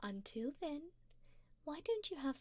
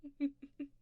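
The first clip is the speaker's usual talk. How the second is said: short closed-mouth chuckle; amused chuckle; 3 semitones higher than usual. Acted. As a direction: chuckle indulgently at your darling. Amused vampire doting on darling.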